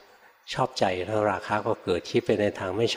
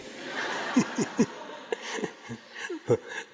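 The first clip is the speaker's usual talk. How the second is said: breathy chuckle; 13 semitones higher, 6 dB quieter than usual. Real, not acted. Monk laughs off the insult.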